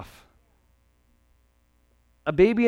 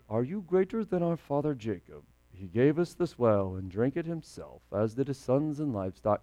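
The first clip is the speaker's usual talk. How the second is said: mumbled, softer, toneless reading of the verse; flat Bible reading; 5 semitones lower, 10 dB quieter than usual. Retold or imitated. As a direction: read it like a dry text nobody feels. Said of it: "this woman is getting sassy with Jesus".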